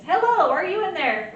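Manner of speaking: high, loud calling hello. Shown as a demonstration. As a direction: call into the patient like knocking. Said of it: "You can say any of those things".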